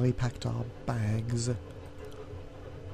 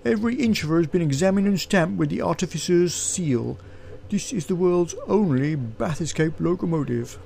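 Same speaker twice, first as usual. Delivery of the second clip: higher-pitched guard character voice; proud train guard; 6 semitones higher, 3 dB louder than usual. Acted. Guard boasting of his train to passengers, proud guard voice. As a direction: declare it as an official would.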